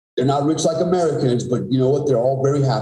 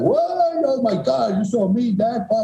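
put-on high, whiny teenage protest; mocking teen daughter; 9 semitones higher than usual. Daughter protesting to her dad in a high, whiny mock voice. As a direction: mimic a daughter rolling her eyes at Dad.

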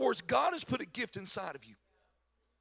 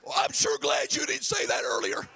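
wheezing through laughter; laughing reply; 7 semitones lower, 5 dB louder than usual. Real, not acted. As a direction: say it while cracking up. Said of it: "he comes back and said".

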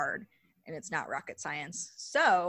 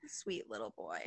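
cooing; 10 semitones lower, 9 dB quieter than usual. Real, not acted. Cooing over partner.

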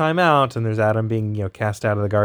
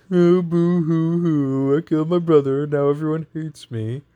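fake crying; 6 semitones higher than usual. God mocking Cain's whining.

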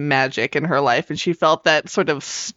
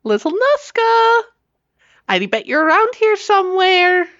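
high singsong call, raised voice; 4 dB louder than usual. Acted. Player's witch calling to a nearby creature, calling out. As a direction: call out for a small hidden creature. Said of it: "she just kind of calls out".

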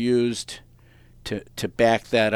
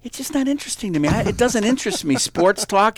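higher, brighter mock sister voice, rushing the middle; imitating his sister; 9 semitones higher, 4 dB louder than usual. Host mimics his sister explaining she finds sports talk dull, higher and quicker. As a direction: play the sister kindly brushing off sports talk. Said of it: "She goes".

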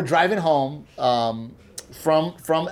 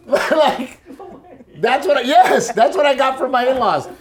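high, laughing disbelief; 10 semitones higher, 5 dB louder than usual. Real, not acted. Husband telling his wife's family story, laughing.

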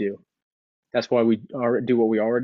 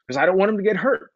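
much higher, flustered stammering parent; worked-up parent; 10 semitones higher, 5 dB louder than usual. Retold or imitated. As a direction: defend yourself, rattled and high. Parent answering why they yelled.

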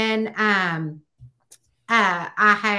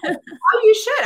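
high, loud burst of approval; real enthusiastic endorsement; eager encouragement; 12 semitones higher, 7 dB louder, slower than usual. Real, not acted. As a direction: urge them on excitedly. Eagerly encourages the other host.

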